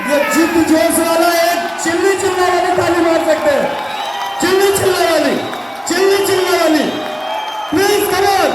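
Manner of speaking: high-pitched hype shout to the crowd; rousing shout. Real, not acted. Trainer demanding frenzied clapping from the hall, rousing shout. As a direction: whip the audience into wild applause.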